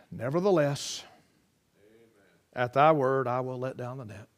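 reluctant, worn-out obedience; weary resignation. Retold or imitated. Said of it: "Can you kind of hear the tone of his voice?".

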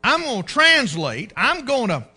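loud, indignant challenge; angry outburst. Acted. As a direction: act out a furious general defying his king. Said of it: "he reacted angrily".

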